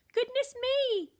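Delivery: loud, high startled cry; alarmed cry. A narrator's reading. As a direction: gasp it out in alarm. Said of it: "cried the giantess".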